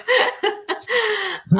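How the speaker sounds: bright burst of laughter; high laugh. Real, not acted. Mocking her own outfit, high laugh.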